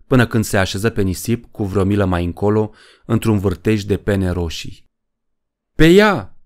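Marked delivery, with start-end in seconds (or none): 5.8-6.4 loud, high battle cry; shouted attack order. A narrator's reading. Poe sending his army at the rocket, loud shout. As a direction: shout the charge, pitch leaping up. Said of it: "strigă Poe".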